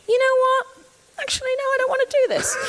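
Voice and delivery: high, loud mock diva announcement; exaggerated high-pitched change of mind; mock sudden refusal. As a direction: put on a high voice and pull out breezily.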